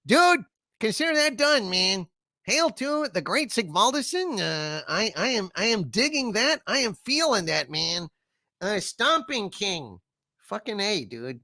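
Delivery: high-pitched laid-back creature voice, digging it; Harvey voice, pleased. Acted. Harvey thanking a fan for the song request, pleased and high.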